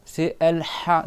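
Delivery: slow throaty ha from mid throat; slow Arabic ha; Arabic ha sound. Shown as a demonstration. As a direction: stretch "Al-Ha" with the ha from the middle of the throat. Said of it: "c'est le milieu de la gorge".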